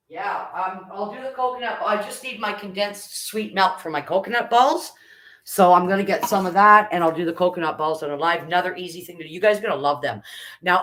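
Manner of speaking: ordinary delivery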